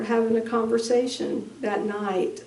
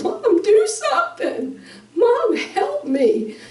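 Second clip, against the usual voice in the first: high, choked plea for help; high tearful mother retelling her son's plea; tearful pleading; 13 semitones higher, slower than usual. Real, not acted. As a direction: voice breaking as you plead. Dying son's pleading look.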